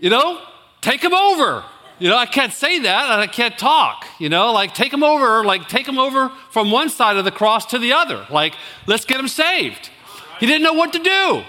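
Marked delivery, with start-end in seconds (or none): 0.8-9.9 much higher and louder, mock frantic; hammed-up silent pleading to a panicking kid; frantic urging. Urging a panicked teen, mouthing silently. 10.4-11.4 much higher and louder, nearly shouting; excited laugh. Real, not acted. Laughing retelling of panic.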